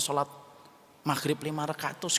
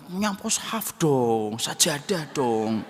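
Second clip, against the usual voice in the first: mock preachy advice; 4 dB louder, slower than usual. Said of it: "nggak mungkin saya langsung ngomong gitu".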